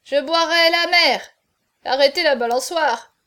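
loud tipsy bluster; drunken boast. A narrator's reading. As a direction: bellow it like a reeling drinker. Drunken marquis boasts and protests.

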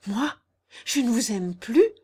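a touch of tearful disbelief; choked by sudden tears. A narrator's reading. Jeanne accused of not loving him, choked by tears. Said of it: "suffoquée par des larmes soudaines".

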